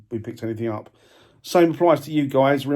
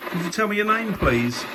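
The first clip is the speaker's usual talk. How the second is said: raised, high voice calling out; 6 semitones higher than usual. Real, not acted. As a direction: call out to an unseen spirit. Calling out to spirits.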